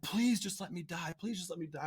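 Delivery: imitating a desperate sob to God; begging through remembered tears; retold crying plea. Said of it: "I'm crying like".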